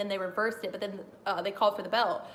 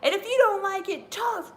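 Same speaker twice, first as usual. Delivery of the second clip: imitating Bret Hart; 10 semitones higher, 5 dB louder than usual. Retelling Bret Hart's promo, a high mock rant. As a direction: ham up an angry wrestler's promo. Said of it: "And then he says".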